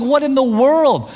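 high, loud, slow what in the world; baffled. Retold or imitated. Father amazed his son finally went, slow high disbelief. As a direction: drag it out in disbelief. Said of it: "I'm like".

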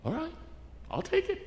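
high, soft pleased voice; pleased acceptance. Acted. Three o'clock worker taking his windfall, pleased. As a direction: accept it with quiet delight. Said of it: "I bet he's sitting there going".

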